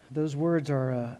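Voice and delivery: ordinary talk, nothing marked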